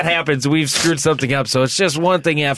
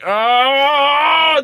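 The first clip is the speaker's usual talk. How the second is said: put-on kid's startled cry; 11 semitones higher than usual. Kid startled by angry face.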